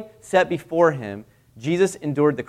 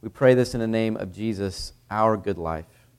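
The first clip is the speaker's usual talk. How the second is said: prayer; 4 semitones lower than usual. Acted. Pastor leads the church in closing prayer, reverent.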